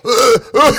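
high, loud laughing groans of disgust; wife's disgust. Retold or imitated. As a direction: groan like you stepped in something vile. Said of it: "so she's like".

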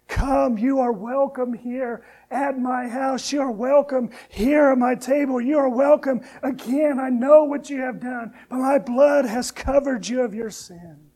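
loud, ringing call of the Lord; booming divine invitation; voice of the Lord, preached. Acted. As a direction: proclaim it as God calling his people home. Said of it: "The Lord says".

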